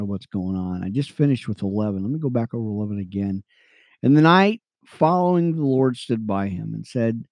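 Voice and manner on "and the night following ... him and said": earnest Bible reading, lifting on names; steady scripture read. Acted. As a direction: read it like a preacher to his radio friends.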